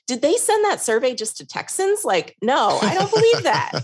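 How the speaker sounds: high-pitched mock disbelief; retold incredulity. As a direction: scoff at it, pitch climbing towards laughter. Retelling her incredulous reply to husband.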